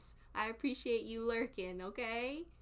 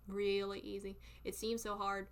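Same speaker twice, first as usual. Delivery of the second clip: ordinary talk, nothing marked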